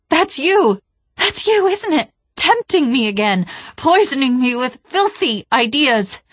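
high, loud, furious outburst; frantic accusation. A narrator's reading. Cortana fighting off the Gravemind, frantic accusation. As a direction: hurl it at a tormentor, high-pitched.

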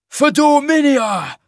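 high, long-drawn rallying shout; battle cry. A narrator's reading. Rebel leads the charge on officers, shouted war cry. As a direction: shout it across the field, stretching the last word.

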